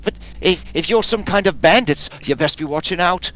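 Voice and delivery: put-on high old woman voice, wary and warning; suspicious old crone cautioning bandits; old woman warning the campers off, high and wary; menacing old woman. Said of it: "threatens you with it, uh, menacingly".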